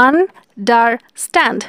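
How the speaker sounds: Understand said slowly in three parts; slow down and split understand; syllables drawn out. Shown as a demonstration. Teacher counting syllables for students, slow. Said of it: "three syllable".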